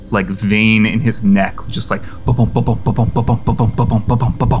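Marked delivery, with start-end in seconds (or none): 1.7-4.6 heartbeat sound. A narrator's reading. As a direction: tap out the heartbeat with your voice. Narrating the pounding pulse.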